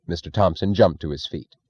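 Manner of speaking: plain reading voice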